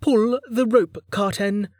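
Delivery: high-pitched, weary character voice; a touch of weary impatience, pitched high; tired. A narrator's reading. Lin tells Carten to pull the rope, tiredly. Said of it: "Lin said tiredly".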